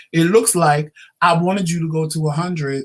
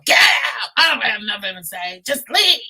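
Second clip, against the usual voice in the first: retold yell; 6 semitones higher than usual. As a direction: yell at her to get out. Husband yelling to leave.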